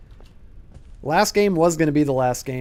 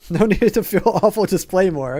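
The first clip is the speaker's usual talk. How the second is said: laughing; 3 semitones higher, 4 dB louder than usual. Real, not acted. Laughing reassurance to chat.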